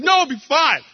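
high eager wife voice; wife spotting the photo booth, hammed-up high; excited wife voice. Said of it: "She's like".

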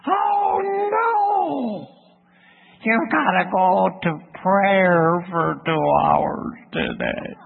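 slow, high mock wail sinking down; drawn-out mock dismay, starting very high; whining inner voice. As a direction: groan it out as a dreading voice in the head. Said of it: "this voice came to me".

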